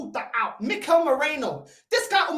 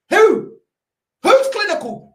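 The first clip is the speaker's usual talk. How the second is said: angry outburst; 7 semitones higher, 8 dB louder, slower than usual. Real, not acted.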